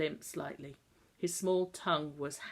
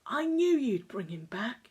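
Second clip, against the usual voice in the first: put-on higher boy's voice, mild ferocity; a boy's mildly fierce, tearful insistence; said fiercely; 6 semitones higher, 3 dB louder than usual. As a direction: insist through tears, lightly. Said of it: "he said fiercely".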